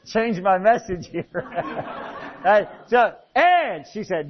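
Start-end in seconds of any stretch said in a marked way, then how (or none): none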